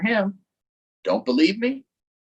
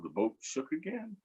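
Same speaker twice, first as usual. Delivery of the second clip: ordinary delivery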